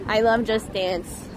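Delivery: usual speaking voice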